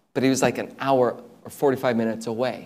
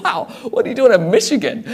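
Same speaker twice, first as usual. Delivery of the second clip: laughing, higher and louder, amazed; laughing wow; 11 semitones higher, 6 dB louder, faster than usual. Retold or imitated. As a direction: burst out in a laughing wonder.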